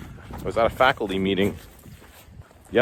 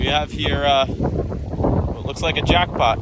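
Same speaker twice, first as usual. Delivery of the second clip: loud and raised outdoors; shouting over wind; 6 semitones higher, 4 dB louder than usual. Real, not acted. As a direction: project over the roaring wind. Still on windy beach.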